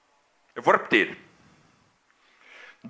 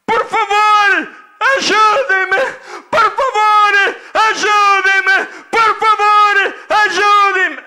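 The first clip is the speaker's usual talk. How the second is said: high, loud, repeated pleas for help; desperate shouting; 12 semitones higher, 7 dB louder, slower than usual. Acted. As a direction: scream it over and over, begging. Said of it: "Ele vinha a gritar". (gritos repetidos de socorro)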